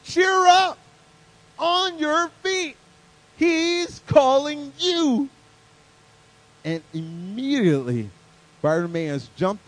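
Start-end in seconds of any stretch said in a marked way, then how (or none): 0.0-5.3 high, loud, long-drawn holler; big rousing shout, very slow; calling out. A narrator's reading. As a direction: holler it across a crowd, stretching each phrase. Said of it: "they called to the blind man".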